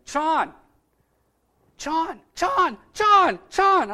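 high, loud calls with a "ch" start, "Chon!"; imitated child's yelling. Little boy running down a hill to his sponsor, imitated yelling. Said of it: "running down a dirt hill yelling".

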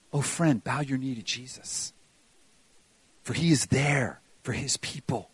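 low, soft preaching with long pauses; quiet, grave reassurance; hushed pastoral appeal. Real, not acted. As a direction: drop down and plead gently with the congregation.

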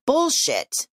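a sudden high, loud outburst; angry outburst. Acted. Mother calls the police chief's words a lie, high and loud. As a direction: spit it out in disbelief. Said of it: "she said".

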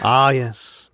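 usual speaking voice

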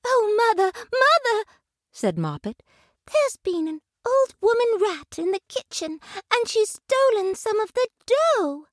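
high, breathless kitten crying for mother; a scared kitten reporting to her mother; frightened kitten. A narrator's reading. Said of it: "in a terrible fright".

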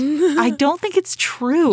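high-pitched, drawn-out protest; upset disbelief. Real, not acted. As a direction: refuse to accept it, slowly and high. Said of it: "I'm upset".

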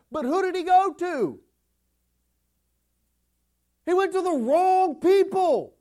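loud, high, fiery pulpit delivery; full-throated preacher, driving every line; preaching at full force. Real, not acted. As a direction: thunder it out to the congregation.

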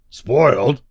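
doubting, rising one-word question; dubious. Acted. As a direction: question it with suspicion.

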